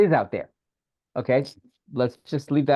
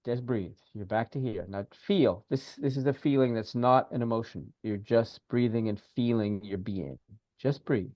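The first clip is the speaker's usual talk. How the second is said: soft, lower breathing cues; guided breathing; 4 semitones lower, 5 dB quieter than usual. Acted. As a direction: ease them back out of the emotion. Guides client back to breath.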